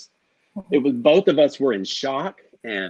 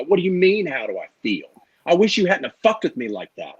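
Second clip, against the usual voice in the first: higher-pitched indignant challenge, dipping mid-way; retold angry confrontation; 5 semitones higher than usual. Player retelling calling out the producer on camera, angry. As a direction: call someone out, retelling the anger. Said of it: "I remember being so angry and calling her out".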